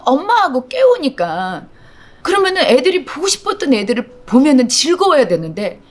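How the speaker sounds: ordinary talk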